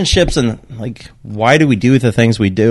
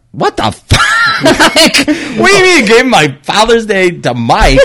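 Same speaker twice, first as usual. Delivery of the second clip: half-laughing outrage, pitched way up; laughing disbelief; 10 semitones higher than usual. Retold or imitated. Retelling dad's regift, laughing high disbelief.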